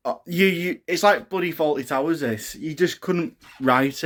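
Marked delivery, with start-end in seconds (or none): none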